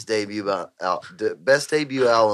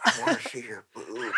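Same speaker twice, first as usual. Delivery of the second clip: movie quote; 10 dB quieter than usual. Retold or imitated. Quotes Little Nicky scene.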